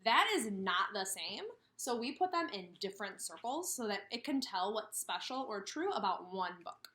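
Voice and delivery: usual speaking voice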